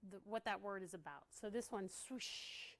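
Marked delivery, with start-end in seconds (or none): 1.9-2.7 "swoosh" breathed out as a whisper. Shown as a demonstration. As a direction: let "swoosh" rush out unvoiced like wind. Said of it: "when you say that word, you can feel that word".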